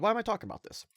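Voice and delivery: usual speaking voice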